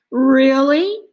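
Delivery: high, loud amazed question; light up with surprise; surprised. A narrator's reading. Arthur hearing the dentist's story, surprised. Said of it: "said Arthur".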